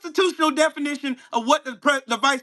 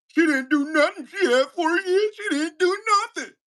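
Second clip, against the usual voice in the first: mock-emphatic parroting, rising higher with each repeat; emphatic mock quote; 4 semitones higher than usual. Ranting at Jay, mockingly parroting his insistent claim. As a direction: hammer the critic's line in a mock whine, climbing up. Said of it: "And you say that shit emphatically".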